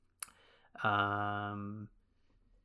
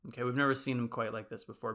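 ordinary talk, nothing marked

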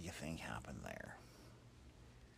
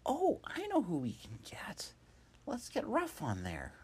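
excited idea; 9 dB louder than usual. Acted. Hop gets an idea.

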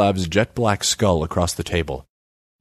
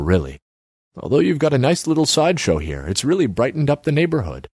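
higher-pitched, breezy politician; lightly voiced, higher-pitched politician; politician character; 7 semitones higher than usual. A narrator's reading. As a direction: explain it with patronizing ease. Politician lectures in story.

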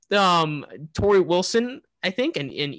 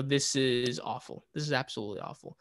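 muttered to himself; 3 semitones lower, 9 dB quieter than usual. Retold or imitated. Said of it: "I was saying to myself".

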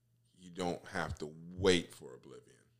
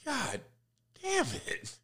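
exasperated; slower than usual. Real, not acted. Rant peak.

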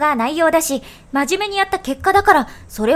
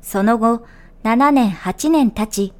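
calm, measured folk tale reading over music; gentle storytelling voice; story narration; 4 semitones lower than usual. A narrator's reading. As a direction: tell the old tale evenly, like a radio storyteller. Reads folk tale on radio show.

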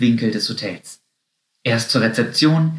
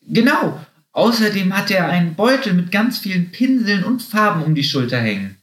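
bunny voice; 8 semitones higher than usual. A narrator's reading. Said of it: "fuhr Lotte fort".

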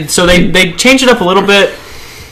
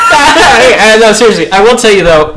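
higher, louder, still giddy; excited; 6 semitones higher, 5 dB louder, slower than usual. Real, not acted. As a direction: jump back in, still excited. Recovering from laugh.

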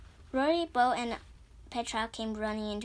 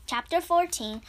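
plain reading voice

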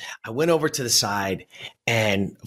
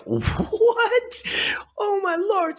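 very high, hammed-up disbelief; mock a mother's squeal of shock; shocked parent; much slower than usual. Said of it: "any parent, like".

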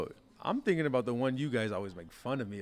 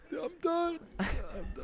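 high, strained mock plea to the coach; exhausted plea. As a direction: squeak out that you're finished. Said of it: "I'm like, "Coach".

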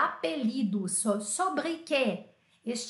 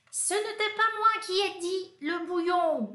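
put-on childish protest; 7 semitones higher than usual. Pupil protesting in the story.

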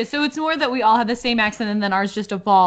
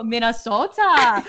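slow, exaggerated Minnesota accent; much slower than usual. Teasing co-hosts' habit of saying it, exaggerated Minnesota accent. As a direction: stretch it out in a laughing mock Minnesotan voice. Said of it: "every single person has to go".

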